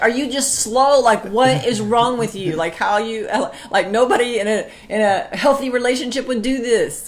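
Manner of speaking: high, loud, scornful rant; a wife berating her husband in an old fight. Retold or imitated. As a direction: berate him like he's a fool. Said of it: "I used to say that to him".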